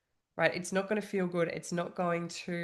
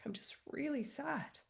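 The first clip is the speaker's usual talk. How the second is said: breathy whisper, pitched up and soft; whispered sad quote; 8 semitones higher, 8 dB quieter than usual. Retold or imitated. Telling husband she was sad, in a whisper. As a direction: whisper it like a confession of sadness. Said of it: "telling him that I was really sad".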